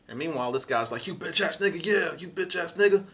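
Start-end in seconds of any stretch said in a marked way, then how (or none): 1.1-3.0 high, rising street taunt; mock the driver like a thug. Stranger taunting, voiced.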